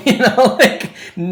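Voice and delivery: words breaking up into real laughter; laughing. Real, not acted. Laughing at own cowboy impression.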